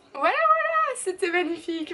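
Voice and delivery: high, loud sing-out; playful triumph. Real, not acted. Vlogger wrapping up her answer in English, playfully triumphant. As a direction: pitch leaps up in mock-grand celebration.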